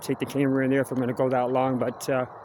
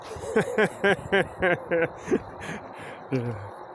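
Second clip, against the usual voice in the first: long wild animal-like cackle; animal laugh; 2 semitones higher, 4 dB quieter than usual. Pretend. Vlogger pretending to be an animal, wild cackle. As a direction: let out an animal howl of laughter.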